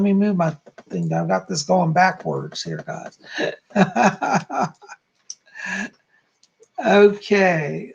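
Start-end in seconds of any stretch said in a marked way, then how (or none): 3.3-4.7 short amused laugh. Real, not acted. Laughs at own mistake.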